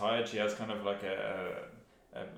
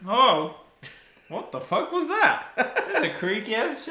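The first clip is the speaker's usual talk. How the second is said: surprised; 12 semitones higher, 6 dB louder than usual. Real, not acted. Reacting to a creak.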